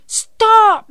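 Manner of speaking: high, loud urgent shout; yelling. A narrator's reading. Janey to the adults chasing the rooster, yelling. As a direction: yell to halt the chase. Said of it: "yelled Janey".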